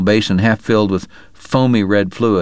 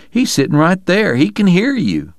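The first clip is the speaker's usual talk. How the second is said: mild outcry; 10 semitones higher than usual. Acted. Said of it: "Morgan cried".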